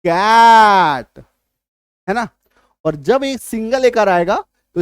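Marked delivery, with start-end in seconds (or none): 0.0-1.2 "कैट" with a hugely stretched, loud ae vowel; said with force. Shown as a demonstration. Teacher demonstrating the double matra, forceful drawn-out "कैट". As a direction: push hard on "कैट", dragging out the open ae. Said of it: "उच्चारण थोड़ा जोर से करते हैं".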